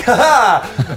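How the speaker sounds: high, triumphant "ha ha"; hammed-up heroic cackle; swashbuckling laugh. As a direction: laugh boldly as you rejoin the fight. Captain bounces back into the fight.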